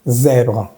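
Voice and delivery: "Zèbre" ending on the R, no final E; stops at R. Shown as a demonstration. Teacher shows where pronunciation stops, "Zèbre" without final vowel. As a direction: cut "Zèbre" off at its light French R. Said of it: "se detiene hasta la R".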